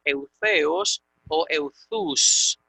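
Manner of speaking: eutheos o euthus spelled out very slowly, each syllable distinct; slow Greek pronunciation. Shown as a demonstration. Teacher slowly giving students both forms of the adverb. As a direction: draw out eutheos o euthus for dictation. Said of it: "Tiene dos formas".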